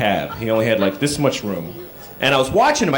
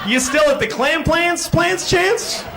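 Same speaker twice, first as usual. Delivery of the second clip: high, slowed, gleeful nonsense; crazy cheerful greeting; 10 semitones higher, slower than usual. Retold or imitated. Greeting a forgotten acquaintance, high, slow and gleefully nonsensical. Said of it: "Just go".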